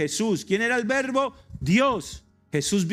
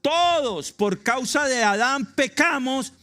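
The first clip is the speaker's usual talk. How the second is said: fiery preaching; 7 semitones higher, 4 dB louder than usual. Acted. Preacher calls the congregation to believe, in fiery sermon voice. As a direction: preach it with rising fervor to the congregation.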